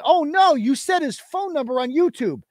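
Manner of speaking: high, mocking "Oh, no" in a fake-shocked voice; sarcastic high mock of the prosecutors; mocking whine. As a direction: parody their outrage. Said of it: "while claiming that".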